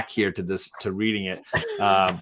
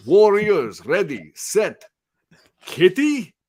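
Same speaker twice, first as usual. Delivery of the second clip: higher, hammed-up Russian accent; Colossus, Russian accent; 7 semitones higher, 6 dB louder, much slower than usual. Host acting out the comic panel, theatrical Russian accent. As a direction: call it out in a mock Russian accent. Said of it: "Colossus's lines with a Russian accent".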